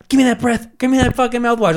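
high, urgent, hammed-up panic; high hammed-up frantic demand; frantic plea. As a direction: beg for the mouthwash in a high rush. Panicked self-quote hiding drinking.

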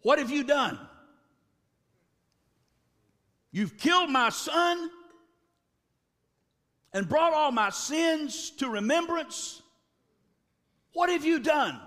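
anguished cry. Retold or imitated. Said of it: "she said to the man of God".

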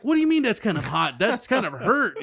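incredulous, laughing protest; incredulous retort. Retold or imitated. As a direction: push back in loud, high-pitched disbelief. Mock incredulous reply in story.

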